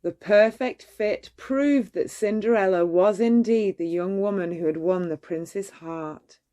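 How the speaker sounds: ordinary delivery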